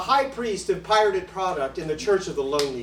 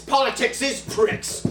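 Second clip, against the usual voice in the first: a lightly pitched-up blurt; Syndrome's voice; 6 semitones higher than usual. Shown as a demonstration. Comic voicing his Tourette's alter ego, blurting. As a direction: blurt it out like a tic. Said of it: "as Syndrome would say".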